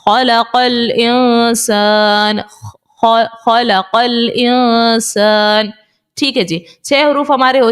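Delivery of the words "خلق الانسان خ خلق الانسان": very slow, drawn-out recitation on a level pitch; measured tajweed recitation; Quranic recitation. Shown as a demonstration. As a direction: recite it slowly, holding the long vowels. Demonstrates Quran recitation for students.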